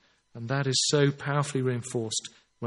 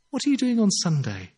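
lightly voiced, higher-pitched friend's invitation; a hint of a hospitable hostess, higher; imitating hospitable Highland woman; 7 semitones higher, 3 dB louder than usual. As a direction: lift it lightly, inviting a student over. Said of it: "It's West Highlands".

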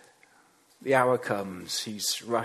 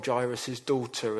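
ordinary talk, nothing marked

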